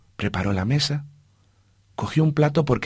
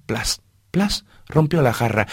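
usual speaking voice

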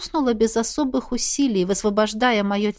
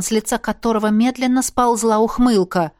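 ordinary delivery

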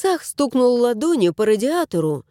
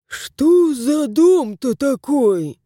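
exasperated grumble; 3 semitones higher, much slower than usual. A narrator's reading. House spirit Yeremey grumbles at the cat, exasperated and slow. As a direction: stretch it out in irritation. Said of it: "Еремей в сердцах".

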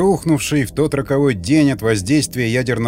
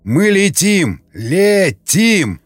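slow, higher-pitched exultant cry; joyful triumph; 5 semitones higher, 3 dB louder, much slower than usual. A narrator's reading. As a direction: stretch it out in delighted triumph. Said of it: "от радости и восторга". (ликующий протяжный возглас)

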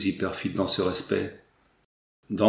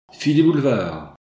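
provocation; 3 semitones higher, 5 dB louder than usual. Acted. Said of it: "l'exclamation provocatrice de Louise".

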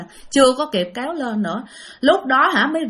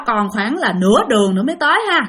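ordinary delivery

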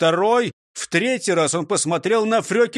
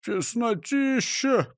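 slow, high-pitched moan; complaining, drawn-out groan; 7 semitones higher, slower than usual. A narrator's reading. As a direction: groan it out, stretching the word. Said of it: "простонал Крендель".

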